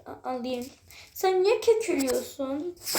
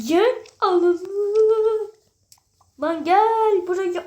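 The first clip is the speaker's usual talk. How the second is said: loud higher call; shout; 7 semitones higher, 8 dB louder, much slower than usual. Real, not acted. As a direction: yell for her to come here. Partnerini bağırarak çağırıyor. (bağırarak çağırma)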